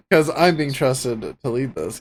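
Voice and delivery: normal talking voice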